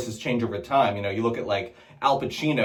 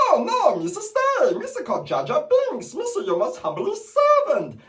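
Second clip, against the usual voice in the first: high floppy alien voice; silly high pleading; Jar Jar Binks. Retold or imitated. Gungan begging politely, high and silly. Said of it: "sounds a little bit like".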